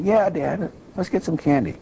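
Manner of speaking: a hint of excited children; eager kids.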